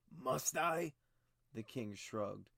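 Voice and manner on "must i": high, loud mocking challenge; sharp retort. A narrator's reading. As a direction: toss the question back with scorn. King mocks maester's excuse.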